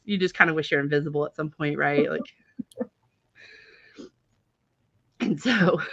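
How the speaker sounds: ordinary delivery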